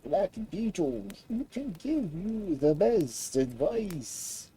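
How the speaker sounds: a small frail creature speaking carefully; dragonfly voice. A narrator's reading. Dragonfly warns the prince of danger, high and slow.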